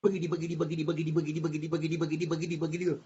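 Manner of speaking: rapid soft rhythmic patter; scurrying noise. Acted. Robbers flee the house, quick soft scurrying patter. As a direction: rattle it off like scampering feet.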